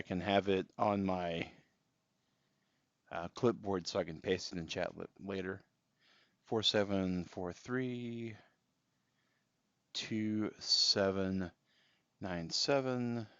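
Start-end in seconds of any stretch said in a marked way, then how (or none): 6.5-13.3 each digit read out slowly and evenly; slow, careful number reading; slow dictation. Real, not acted. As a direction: spell the code out slowly for chat. Reading out friend code digits.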